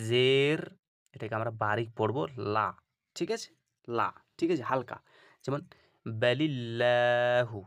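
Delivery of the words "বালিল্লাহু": বালিল্লাহু drawn out slowly, thin light ল; recited light. Shown as a demonstration. A Quran teacher to students, reciting a light example. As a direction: chant বালিল্লাহু slow and thin. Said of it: "লা পড়ছি".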